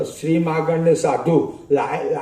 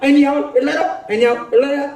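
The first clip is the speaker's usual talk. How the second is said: shooing women away in a much higher mock voice; mocking a fake sadhu; 8 semitones higher, faster than usual. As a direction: parody the hypocrite keeping women off. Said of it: "પાખંડીઓ કઈ જુદો ડોર કરે". (ઊંચા અવાજે પાખંડી સાધુની નકલ)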